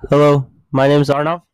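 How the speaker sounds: right up on the microphone; leaning into the mic. Real, not acted. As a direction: close to the mic. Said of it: "we gotta lean into the microphone".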